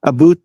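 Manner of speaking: "A boot" said as about with an oo vowel; regional Canadian pronunciation. Shown as a demonstration. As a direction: round the second vowel of "A boot" into oo. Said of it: "depends on the province".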